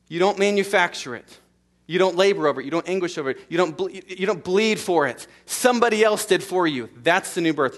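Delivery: loud, insistent repetition; fervent preacher; preaching at full force. Acted. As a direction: press the point on the crowd.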